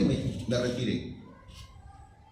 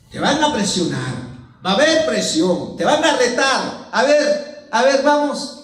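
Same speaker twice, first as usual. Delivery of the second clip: loud, raised warning from the pulpit; forceful preaching; 9 semitones higher, 8 dB louder, slower than usual. Acted. Preacher warning brethren they will be pressured, forceful preaching. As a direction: press the congregation hard.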